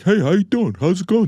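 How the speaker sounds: high, put-on New Yorker greeting; imitating a New York guy saying hi; New Yorker impression. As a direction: do your buddy's New Yorker voice. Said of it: "I'll do an impression of them".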